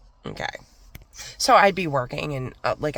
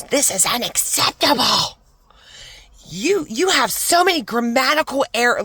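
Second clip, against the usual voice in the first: mocking scolding boss; 9 semitones higher, 6 dB louder than usual. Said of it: "He calls me in the office".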